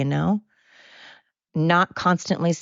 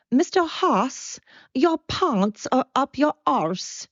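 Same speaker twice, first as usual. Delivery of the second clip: high, slow, badly faked South African accent; slaughtered South African accent; 9 semitones higher, slower than usual. Exchange student calling out to her teacher, mock South African accent. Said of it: "I cannot do a South African accent".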